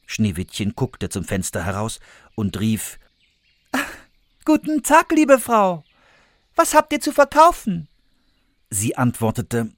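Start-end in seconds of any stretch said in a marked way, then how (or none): 4.4-7.9 much higher, louder friendly call; sweet girl. Acted. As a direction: greet the old woman brightly from the window.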